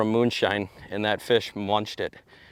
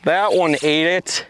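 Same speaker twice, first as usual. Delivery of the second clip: excited; 6 semitones higher, 6 dB louder, slower than usual. Real, not acted. Fish on, repeats excitedly.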